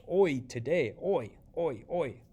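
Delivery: loud, high "Hoy" over and over; repeated cue. Shown as a demonstration. Teacher cueing students with today, loud and insistent. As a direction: hammer "Hoy" like a pointing cue. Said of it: "point down like".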